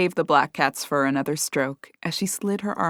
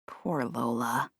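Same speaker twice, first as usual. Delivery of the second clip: sad; 6 semitones lower, 8 dB quieter, slower than usual. A narrator's reading. Cat grieving softly.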